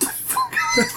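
usual speaking voice

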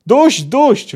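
a dwarf yelling to stop the banging. Acted. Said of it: "wrzasnął po chwili Zoltan".

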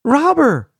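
high, loud call for help; yelling. A narrator's reading. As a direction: keep yelling the alarm. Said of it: "he yelled".

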